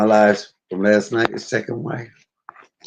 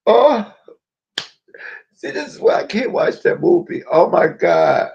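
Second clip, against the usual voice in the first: real tears, pitched up high; high tearful voice, overcome by the scene; crying; 8 semitones higher than usual. Real, not acted. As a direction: talk through your crying. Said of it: "I cry every time".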